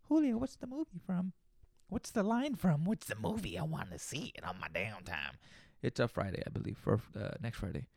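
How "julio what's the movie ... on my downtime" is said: imitating a listener. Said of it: "watch the movie".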